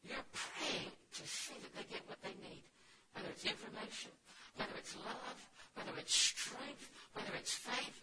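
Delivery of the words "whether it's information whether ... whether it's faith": each phrase louder and more drawn out; swelling sermon build; building preacher cadence. Acted. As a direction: climb in force through the list.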